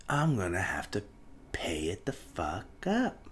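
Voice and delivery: hushed whisper, slowing at the end; whispered aside. Real, not acted. Grumbling to listeners about debt, whispered. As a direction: drop to a whisper and let the last words drag. Money complaint, dropped to whisper.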